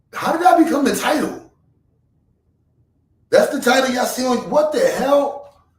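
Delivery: high, loud disbelieving shout; shocked outburst. Real, not acted. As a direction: yell in real disbelief at the title. Shocked at his own title.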